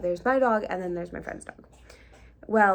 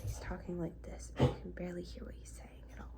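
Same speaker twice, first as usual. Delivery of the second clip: barely audible whispered imitation; extremely quiet; 13 dB quieter, faster than usual. Vlogger mimics the man who approached them, extremely quiet. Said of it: "He's talking extremely quiet".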